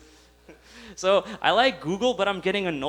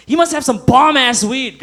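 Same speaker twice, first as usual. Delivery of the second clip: emphatic; 7 semitones higher, 10 dB louder than usual. Real, not acted. Emphatic joke punchline.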